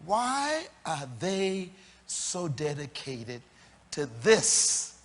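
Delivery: slow, deliberate question, word by word; slow it right down so each word lands; drawn-out preacher's question; said slowly. Shown as a demonstration. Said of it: "I'm gonna say it slow".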